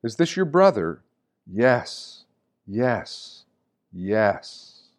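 ordinary talk

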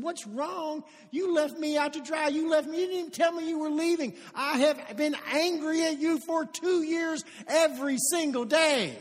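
reenacting a bitter friend's outburst; angry confrontation. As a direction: let two years of resentment spill out. Friend confronts the man who quit.

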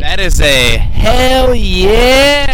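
drawn-out, loud and excited. Real, not acted. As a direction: hype it up, stretch it out. Excited reply about going to Rogers.

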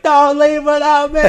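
high, loud drawn-out yell; acted desperate scream to be waited for; retold shouting. Late passenger sprinting to his gate, mock desperate shout. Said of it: "I'm shouting at this point".

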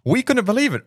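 high, amazed exclamation; excited. Real, not acted. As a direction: say it in disbelief. Reacting to announcement.

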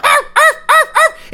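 high yapping little dog barks; yippy dog bark. Retold or imitated. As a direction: yap like a tiny dog.

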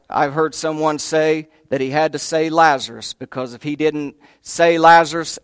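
usual speaking voice

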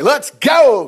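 eager shout. Retold or imitated. Spy urging the people forward, eager shout. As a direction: call out, fired up to go in.

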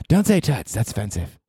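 put-on South Park kid voice, falling away at the end; pretend girlfriend. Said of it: "I am your girlfriend".

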